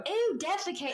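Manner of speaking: high grossed-out ew, defecate; disgusted. Real, not acted. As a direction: recoil at the word defecate. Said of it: "I'm disgusted".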